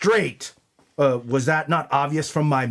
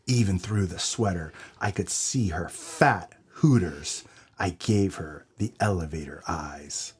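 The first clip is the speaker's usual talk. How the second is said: noir detective narration; 3 semitones lower than usual. Acted.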